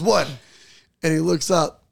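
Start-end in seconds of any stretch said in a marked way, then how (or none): 0.0-0.3 retold loud blurt; loud as shit. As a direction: imitate someone blurting with no stealth. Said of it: "Loud as shit".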